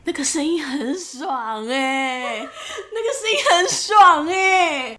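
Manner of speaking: words breaking into high laughter; laughing. Real, not acted. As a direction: giggle through the line. Cracking up over a sound.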